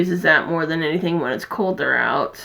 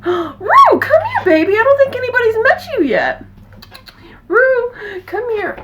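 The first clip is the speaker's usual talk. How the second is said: very high coo calling the cat Rue; gasp into pet talk; 14 semitones higher, 4 dB louder than usual. Real, not acted. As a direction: coax the cat over sweetly.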